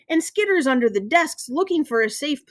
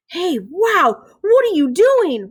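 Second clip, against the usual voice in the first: high, louder, amazed question; excited kid; 7 semitones higher, 5 dB louder, slower than usual. A narrator's reading. Classmate spots Ben holding the mouse, excited. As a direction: blurt it out in astonishment.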